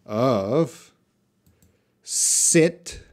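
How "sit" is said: short lax i in "sit". Shown as a demonstration. Teacher modelling "sit" for the class. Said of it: "practice your listening of".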